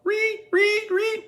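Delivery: very high mock screech of the shower strings; Psycho violin screech. Mom mimicking the shower-scene music, high screech.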